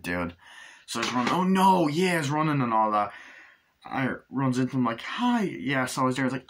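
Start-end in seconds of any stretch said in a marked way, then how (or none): 1.3-2.2 high, loud hammed-up panic; imitating a fleeing man's panic; mock panic. Retold panic while running. 5.0-5.4 high, sing-song mock hello; imitating a cheery girlfriend; sweet greeting.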